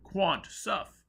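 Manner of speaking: high, loud crowd roar. A narrator's reading. Crowd roaring its chant over fainting Foyle. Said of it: "the scientific people roared".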